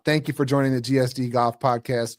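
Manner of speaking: ordinary delivery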